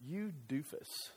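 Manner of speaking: a dry, unimpressed put-down from the wife; wife's deadpan jab. Pretend. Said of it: "Amy would look at me and you go".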